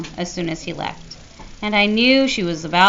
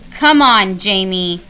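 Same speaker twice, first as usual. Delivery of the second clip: angry; 8 dB louder, slower than usual. A narrator's reading. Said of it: "said Mom angrily".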